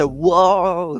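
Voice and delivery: whoa with a wobbling voice; wobbly whoa. Shown as a demonstration. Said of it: "wobble their voice".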